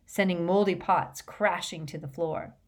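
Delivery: plain reading voice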